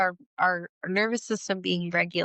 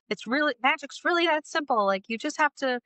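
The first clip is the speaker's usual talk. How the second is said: a hint of a higher, gentle witch grandma; lightly voiced grandma; 6 semitones higher than usual. Quoting the grandma teaching Marty to fly, lightly voiced and higher. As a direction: lightly play the Halloween Town grandma teaching flying. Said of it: "and she says".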